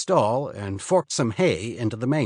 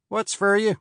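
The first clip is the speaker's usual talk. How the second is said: storekeeper; 9 semitones higher than usual. Acted. Storekeeper voiced in story.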